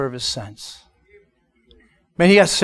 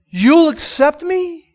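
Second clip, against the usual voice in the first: disbelieving question; 10 semitones higher, slower than usual. Retold or imitated. Crying man asks, incredulous.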